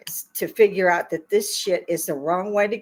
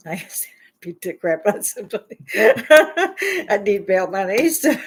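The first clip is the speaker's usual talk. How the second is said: joking through laughter; laughing; 4 dB louder than usual. Real, not acted. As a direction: laugh at yourself.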